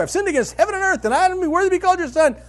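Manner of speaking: "I've sinned against heaven and earth" blurted high and loud, fast; launched plea. Retold or imitated. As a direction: rush the rehearsed confession out at full volume. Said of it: "he launches into a speech right away".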